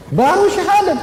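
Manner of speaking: "syahadat" flung out very high in wonder; preacher marvels at Umar's zeal to the congregation, high and amazed; marvelling. Real, not acted. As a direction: exclaim it, astonished. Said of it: "Bayangkan heroiknya".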